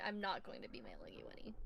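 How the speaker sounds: whispered teasing aside. Real, not acted. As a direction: whisper it as a tease.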